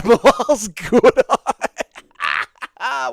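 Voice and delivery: slow, high, laughing stretch of the name; laughing drawl. Acted. Streamer repeating a chatter's name as a joke, drawn out laughing. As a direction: savour the pun through a laugh.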